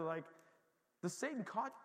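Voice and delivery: ordinary talk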